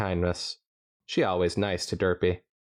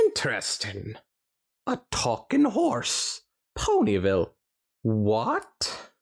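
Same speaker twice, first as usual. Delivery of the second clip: lightly voiced, curious stallion; a hint of a puzzled Doctor; Doctor's voice; 3 semitones higher, slower than usual. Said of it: "he questioned in response".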